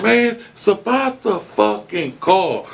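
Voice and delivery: slow, high, irritated retelling; pissed off. Retold or imitated. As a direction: hammer home the point, fed up. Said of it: "I was so pissed off".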